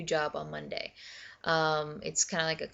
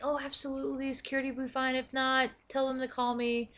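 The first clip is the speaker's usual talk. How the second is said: higher-pitched, agreeable put-on voice; imitated hiring gal; 8 semitones higher than usual. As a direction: mimic an eager yes. Voicing hiring gal's eager approval.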